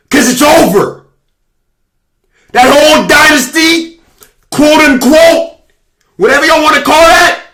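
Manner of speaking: high, sustained shouting at rival fans; loud taunting. Real, not acted. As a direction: shout each phrase as a final verdict. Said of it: "I'll say it louder".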